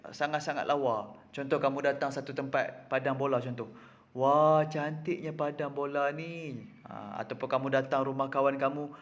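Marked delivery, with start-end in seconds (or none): 4.2-6.6 drawn-out awed "wah"; slow, wonderstruck admiration; amazed exclamation. Shown as a demonstration. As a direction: marvel at it, stretching the words slowly. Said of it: "sangat-sangat excited".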